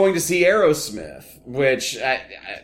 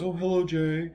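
soft, mild boss's greeting in a put-on voice; understated impression of a softly spoken producer; Lorne Michaels; 6 dB quieter than usual. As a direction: greet him softly, unruffled. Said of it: "Lorne Michaels goes".

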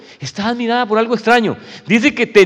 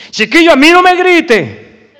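put-on loud, high, slow yell at the child; parent yelling; 7 semitones higher, 7 dB louder, slower than usual. A father yells at his child not to yell, loud and high. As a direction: scold the kid by shouting louder than him. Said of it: "pero le está gritando usted".

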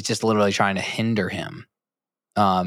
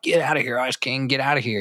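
higher-pitched, mild mock yell; 4 semitones higher, faster than usual. Finn and Jake shooing Ice King away, mild retold yell. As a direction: imitate their yelling without much volume. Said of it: "they keep yelling at him".

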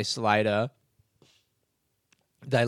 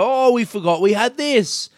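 mock surprise; 8 semitones higher, 5 dB louder than usual. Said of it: "And they go".

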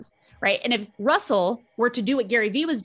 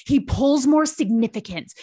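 ordinary delivery

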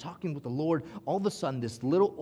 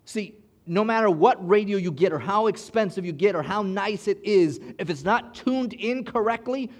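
preaching; 5 semitones higher, 6 dB louder than usual. Acted. Preaching at full force.

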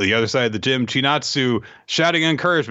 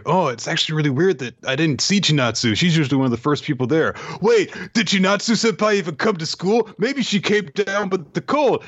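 lively retold thought, climbing much higher; acted flurried wondering; 6 semitones higher than usual. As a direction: build from musing to high-pitched mock concern. Voicing worried character's rising inner thoughts.

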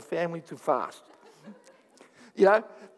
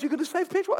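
higher mocking voice of Kevin; 11 semitones higher, faster than usual. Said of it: "And then he goes".

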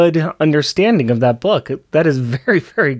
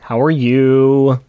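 very slow, stretched words; drawn out; 4 dB louder, much slower than usual. Pretend. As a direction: stretch every word out. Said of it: "We are stretching this".

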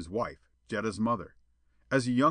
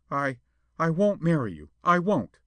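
higher, louder halting refusal; close to tears; 6 semitones higher, 5 dB louder than usual. A narrator's reading. Jetta refuses Perona, close to tears. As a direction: force it out near tears. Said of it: "Her tears were very close".

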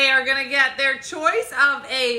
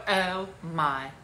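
put-on demonstrated Valley girl drawl; lightly voiced Valley girl; 8 semitones lower, 6 dB quieter than usual. Shown as a demonstration. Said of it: "Just go".

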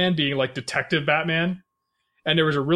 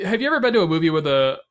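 ordinary delivery

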